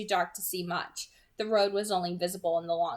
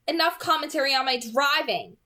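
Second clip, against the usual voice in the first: higher, louder irritated snap; snapping; 7 semitones higher, 8 dB louder than usual. Acted. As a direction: cut him off sharply. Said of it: "she snapped".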